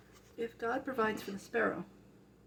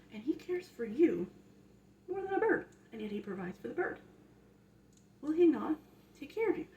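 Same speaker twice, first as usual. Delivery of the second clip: louder and higher, building sermon; preaching; 6 semitones higher, 6 dB louder than usual. Acted. To the viewer about God's care, fervent preaching. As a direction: preach it hard to the listener.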